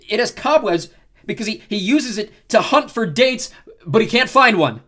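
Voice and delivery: high, loud roast; mocking insult joke. A narrator's reading. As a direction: sell the roast to the whole crowd. Leo delivers the punchline.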